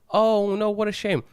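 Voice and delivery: high, lightly voiced shop woman going oh no; a hint of a sing-song shop assistant; shop woman's sympathy. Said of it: "She goes".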